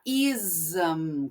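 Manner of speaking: ism said slowly with the syllable drawn out, like "iz-am"; slow teaching demo of ism; dragged ism. Shown as a demonstration. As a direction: drag the ism, "iz" then "am". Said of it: "arrastando esta sílaba".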